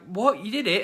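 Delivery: high, loud mock surprise; surprised. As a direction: sound caught off guard, pitched high. Own surprised reply retold.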